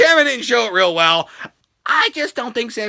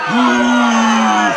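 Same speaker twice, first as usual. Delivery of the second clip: crowd boo; 4 dB louder than usual. Retold or imitated. Imitating the crowd booing.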